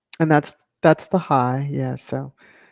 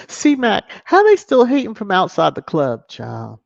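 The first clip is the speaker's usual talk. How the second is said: hyped shout-out; 5 semitones higher, 7 dB louder than usual. Real, not acted. Hyped greeting to a commenter.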